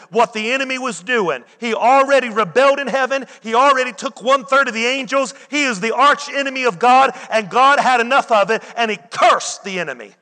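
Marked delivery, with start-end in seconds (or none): 1.6-10.1 louder, rising preacher's build; building preaching. Real, not acted. Preacher builds on the devil's fall, louder and higher. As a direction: drive it home, gathering force.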